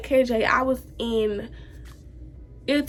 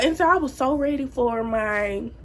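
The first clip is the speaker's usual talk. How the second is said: ordinary talk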